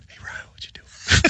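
imitating a man who echoes himself in a whisper; whispered repeat. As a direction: whisper it like a secret echo. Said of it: "it in a whisper".

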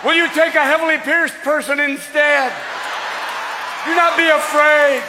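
mock ritual invocation; solemn prayer. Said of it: "You're going".

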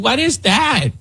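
put-on high laughing valley girl shriek; mock clueless reporter panic. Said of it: "Somebody starts shouting".

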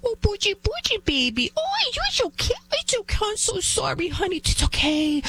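put-on very high, syrupy baby-talk; squeaky, hammed-up fussing over a little one; mock coddling mom. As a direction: imitate a doting mother cooing over her child. Mocks a mom babying her whining son.

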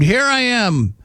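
high-pitched mock cry for help; retold scream. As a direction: call out high without really yelling. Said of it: "from the top of my lungs, I cried out, I screamed".

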